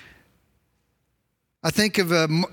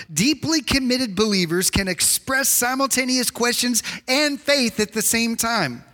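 normal talking voice

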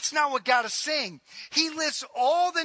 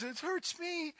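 soft, higher mock parent voice; lightly voiced parent; parent cliché; 5 semitones higher, 11 dB quieter than usual. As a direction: sigh it like a weary parent. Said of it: "as a parent, you always".

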